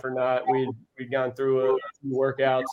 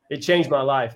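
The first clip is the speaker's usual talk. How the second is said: usual speaking voice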